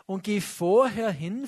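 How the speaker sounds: normal talking voice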